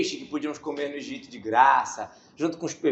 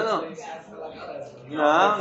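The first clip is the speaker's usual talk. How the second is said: ordinary talk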